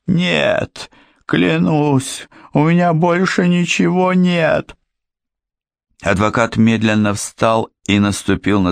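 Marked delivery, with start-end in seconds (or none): 0.1-4.8 high, frightened whine; pleading. A narrator's reading. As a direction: beg in a thin high voice. Cornered criminal swearing.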